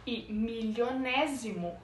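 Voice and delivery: ordinary delivery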